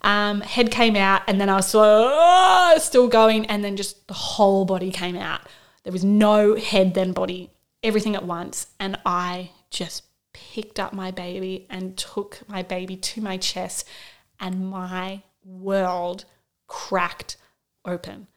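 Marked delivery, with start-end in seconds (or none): 1.8-2.7 higher, louder surprised cry. Retold or imitated. Body coming out. 14.4-18.3 slow, soft, hushed wonder; hushed awe. Real, not acted. Emotional birth moment.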